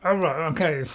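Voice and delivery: normal talking voice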